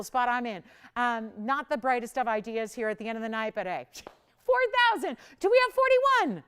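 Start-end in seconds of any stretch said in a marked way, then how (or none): 4.4-6.4 high, loud auctioneer call; pitched-up, projected bid call. Real, not acted. Auctioneer calling out for bids.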